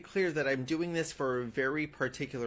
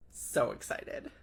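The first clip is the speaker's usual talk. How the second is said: sarcastic; 5 semitones lower, 6 dB quieter, slower than usual. Real, not acted. Sarcastic reaction to ad.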